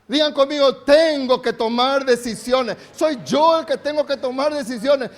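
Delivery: loud, driving preaching; emphatic preaching. Real, not acted. Pastor urging church to make decisions. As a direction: hammer each point.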